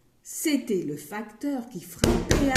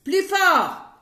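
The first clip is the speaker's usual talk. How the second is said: much higher, loud bark; shouted demand; 10 semitones higher, 10 dB louder than usual. Acted. Grocer yelling at the timid customer to speak up. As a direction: yell it impatiently.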